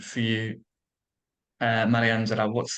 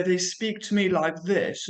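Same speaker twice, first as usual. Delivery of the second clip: put-on higher-pitched, forced received-pronunciation accent; forced British accent; 6 semitones higher than usual. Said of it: "in a very forced".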